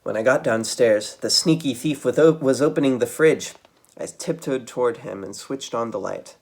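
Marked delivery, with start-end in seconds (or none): none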